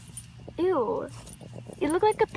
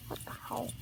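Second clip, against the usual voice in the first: whispered; 4 semitones lower, 15 dB quieter, faster than usual. Real, not acted. Whispered reaction.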